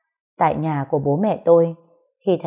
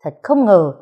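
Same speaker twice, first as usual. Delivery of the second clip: father bellows, much louder; father shouts after pounding the table; 2 semitones higher, 7 dB louder than usual. Acted. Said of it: "lớn giọng quát".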